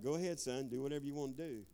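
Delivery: low, soft, knowing warning; God quietly warning a wayward son, low and soft. Retold or imitated. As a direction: speak as God, calm and low. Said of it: "He's like".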